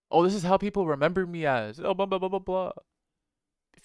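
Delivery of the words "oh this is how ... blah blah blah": higher and louder, a sneering mimic of someone clinging to their past; hammed-up nagging impression, blah-blah tone; mocking whiny voice. Mocking people who cling to how they're remembered, sneering whine.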